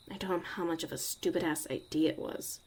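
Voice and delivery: ordinary delivery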